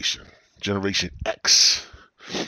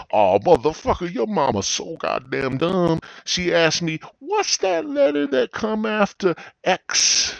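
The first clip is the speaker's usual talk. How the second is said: school bus roast; 10 semitones higher, 4 dB louder than usual. Retold or imitated. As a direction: taunt like a kid going for the kill. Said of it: "put each other down".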